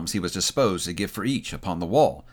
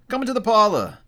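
called out much higher; insistent invitation; 11 semitones higher, 4 dB louder than usual. Acted. Old Joe invites his visitors inside, calling out. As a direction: usher them in with a high, raised call.

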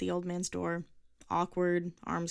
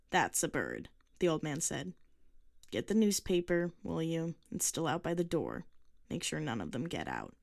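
put-on gruff old man. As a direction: speak it like a curt old man.

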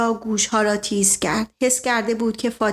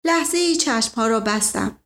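ordinary delivery, nothing marked

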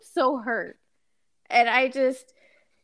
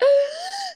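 starstruck gasp. Retold or imitated. Starstruck by celebrity.

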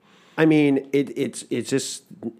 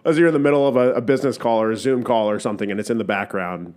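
ordinary delivery

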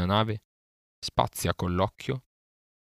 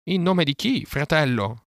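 high, loud questioning call; calling back; 8 semitones higher, 5 dB louder than usual. A narrator's reading. Vulture asks the captive boy who sends him, high call. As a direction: call down from the air, pitched high.

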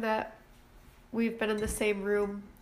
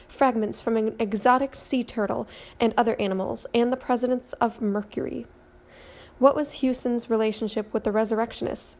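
louder, urgent mystery narration; dramatic teaser read; 5 dB louder than usual. Acted. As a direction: read it like a true-crime trailer. Reads the dramatic podcast teaser.

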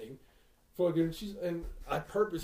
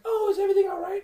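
higher, louder sweet shop-lady voice; 14 semitones higher, 8 dB louder, much faster than usual. Retold or imitated. Son imitating the pet store lady, high sweet clerk voice. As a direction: chirp it like a friendly clerk. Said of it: "the lady's like".

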